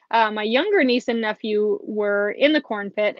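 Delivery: ordinary talk, nothing marked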